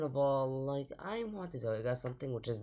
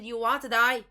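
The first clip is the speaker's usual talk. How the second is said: exasperated squeak on die; incredulous high-pitched challenge; voice jumps higher; 7 semitones higher, 7 dB louder than usual. Real, not acted. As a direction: Do you want to die? pushed higher and louder. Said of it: "did my voice just get higher in pitch".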